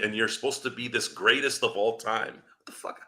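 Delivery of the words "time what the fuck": a voiceless, slowed "what the fuck"; hushed disbelief. Real, not acted. Under his breath to podcast listeners, hushed disgust. Disgusted aside about greatness.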